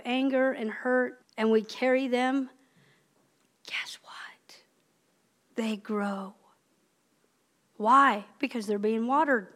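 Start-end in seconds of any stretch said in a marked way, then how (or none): 3.7-6.4 breathy whispered tease. Real, not acted. Preacher whispering a teaser to the congregation. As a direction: lean in and whisper it.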